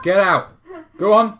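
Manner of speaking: loud, high playful yell; shouting. Real, not acted. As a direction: shout her out of the room. Shooing wife out of room.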